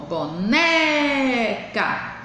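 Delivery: "boneca" with a long, held NÉ; slow boNEEEca stress demo; stressed syllable drawn out. Shown as a demonstration. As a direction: linger on the middle syllable of "boneca". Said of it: "demorar mais com ela na boca".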